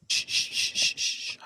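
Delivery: a whispered, voiceless horror-movie shh repeated; Jason Voorhees shushing. Retold or imitated. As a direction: hiss the shh like the slasher film's sound cue. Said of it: "Jason Voorhees".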